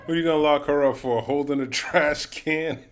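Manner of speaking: loud, high disbelieving yell at the screen; outraged shout. Real, not acted. Watching an arrest video, he shouts in outraged disbelief. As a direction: bellow in outrage at the arrest footage.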